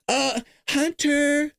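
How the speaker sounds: high, slow mock old man calling Hunter; a high quavering Biden impression. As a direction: call out like a doddering old president. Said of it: "Let me do the Biden voice".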